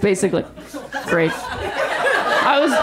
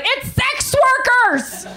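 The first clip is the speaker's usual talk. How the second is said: mock scold; 11 semitones higher, slower than usual. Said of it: "And be like".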